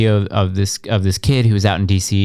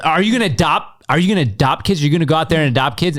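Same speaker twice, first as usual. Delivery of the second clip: mock critic; 6 semitones higher, faster than usual. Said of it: "responding to a lot of people".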